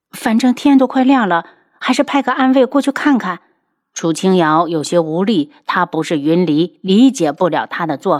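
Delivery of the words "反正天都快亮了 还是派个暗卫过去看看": lightly voiced woman, higher pitch; worried wife. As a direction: urge it anxiously in a higher voice. Voices the worried wife suggesting action. (妻子担忧地提议)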